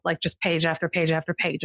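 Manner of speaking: usual speaking voice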